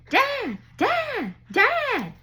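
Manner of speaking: high-pitched mimic of a boy yelling for his dad; imitated frantic calling. Stepson running downstairs for his daddy, imitated frantic high calls.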